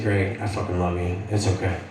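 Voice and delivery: low, hushed tender reassurance. Retold or imitated. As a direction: console him quietly, voice dropped low. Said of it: "the softer side of me comes back".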